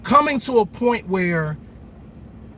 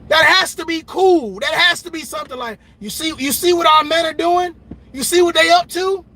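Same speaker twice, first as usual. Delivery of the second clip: excited hype; 9 semitones higher, 4 dB louder than usual. Pretend. Excited imagined women's praise.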